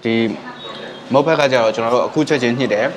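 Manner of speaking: speaking between bites; talking while chewing. Real, not acted. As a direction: mouth partly full of noodles. Seen 0: Takes a noodle bite at -1.0; lips pressed, chewing around +2.0 to +2.3.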